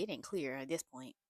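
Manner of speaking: sassy aside. Shown as a demonstration. Recapping a show to viewers, low sassy aside. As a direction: toss off "it ain't clear" low and loose. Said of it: "It is not clear at this point".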